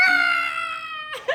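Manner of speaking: high shrieking Ah from the back seat; retold sister's scream. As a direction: scream like a panicked passenger. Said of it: "She's going".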